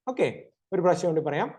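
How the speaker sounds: normal talking voice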